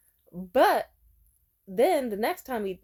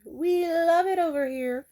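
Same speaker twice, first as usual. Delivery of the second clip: higher, louder, slow sing-song delight; mock delighted; 8 semitones higher, 4 dB louder, slower than usual. Shown as a demonstration. Girlfriend spoiled by her man, gushing in a sing-song. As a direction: gush it like a thrilled girlfriend. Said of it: "repeated differently".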